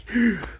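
sharp retold gasp of dread; quick audible intake of breath; imitated gasp. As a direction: suck in air as if dismayed. Retold dread at taking elevator.

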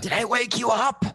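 put-on slow, high drunken question; drunk husband. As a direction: imitate a clueless drunk in bed. Acting drunk husband waking his wife.

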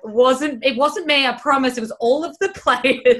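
flustered protest. Retold or imitated. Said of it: "she used to be like".

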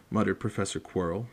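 ordinary delivery

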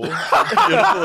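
drawn-out high laughing praise; mock admiration through laughter. Real, not acted.

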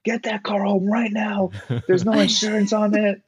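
high-pitched mock yelling; hammed-up angry dad on the phone; screaming dad. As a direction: imitate a furious father barking orders. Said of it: "he's screaming".

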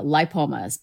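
"lipomas" starting with "lie"; long-i pronunciation. Shown as a demonstration. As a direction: pronounce "lipomas" lie-POH-muhz. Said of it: "I prefer the former".

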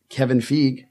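"Feige" said as one syllable, FEEG; mistaken name pronunciation. Real, not acted. Host introduces Marvel's boss, saying "Feige" as FEEG. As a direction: say "Feige" to rhyme with league. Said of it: "I thought it was Feige".

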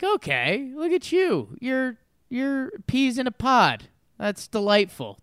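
put-on high sing-song baby talk; gushing at toddlers in costumes; cooing at babies. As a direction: coo over the little ones.

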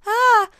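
high, loud panicky yelp; mock nervous little scream. Shown as a demonstration. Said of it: "more nervous in, like".

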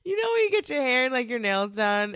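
sudden high, loud burst, fast; excited. Real, not acted. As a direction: gush about fresh hair and nails. Excited about new hair.

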